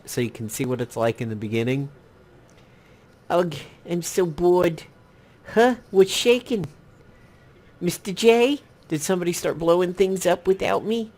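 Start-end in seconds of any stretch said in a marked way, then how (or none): 3.3-11.1 high put-on Harley Quinn voice; squeaky cartoon villainess tease, pitched way up; reading along as Harley Quinn, voice lifted high; Harley Quinn impression. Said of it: "I probably can't do a good Harley Quinn".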